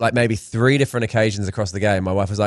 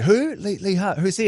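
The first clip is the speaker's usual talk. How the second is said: high-pitched puzzled questioning; mock bafflement; 7 semitones higher, 3 dB quieter than usual. Comedian asks crowd about an unknown name, mock bafflement. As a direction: act out a comedian who has no clue.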